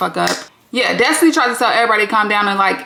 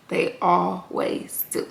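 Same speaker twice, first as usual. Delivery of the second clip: "always" drawn out slow, much softer; hushed knowing repeat; 4 semitones lower, 8 dB quieter, much slower than usual. Shown as a demonstration. Closing the recap with a soft, knowing repeat. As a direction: let "always" linger, quiet and slow.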